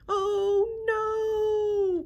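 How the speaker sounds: high, loud drawn-out wail; panicked cry. A narrator's reading. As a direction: cry out long and loud as you tumble. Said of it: "cried the griffin".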